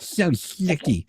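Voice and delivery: So sticky stretched slow and slightly high; teasing slow repeat of So sticky; drawn-out sticky. Pretend. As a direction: drag out So sticky slowly. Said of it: "Say sticky again".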